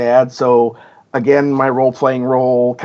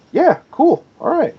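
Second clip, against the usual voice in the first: a quick, much higher breezy "yeah, cool"; casual acted dev reply. Game dev brushing off a fan's idea, breezy and high. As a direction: toss it off lightly, pitched way up.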